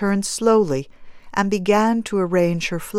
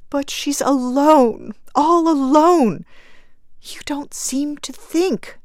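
high, anguished grieving woman; choked; 6 semitones higher, 4 dB louder than usual. A narrator's reading. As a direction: plead through choked-up grief, slowing on key words. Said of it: "choked the woman".

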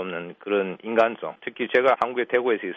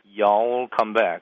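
Southern "You all come back" run together as a long y'all; slow, drawn-out Southern US farewell; Southern US drawl; much slower than usual. Shown as a demonstration. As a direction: stretch "all" and "back" out slowly, Southern style. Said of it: "말끝을 길게 끄는 경향이".

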